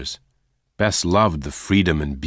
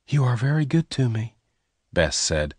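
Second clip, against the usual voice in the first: put-on lightly raised, higher girl's voice; higher voice for the rustler's girl; young woman Bess; 6 semitones higher than usual. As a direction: voice Bess higher and gentle-toned.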